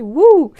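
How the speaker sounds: loud, high playful whoop; a delighted wouh; excited whoop. Real, not acted. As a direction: let out a cheeky whoop. Excited whoop after sexy outfit.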